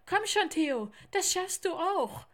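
high, loud urging call; encouraging shout. Acted. Lars urging Theo to climb out and jump, encouraging shout. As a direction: cheer a scared friend on.

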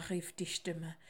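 usual speaking voice

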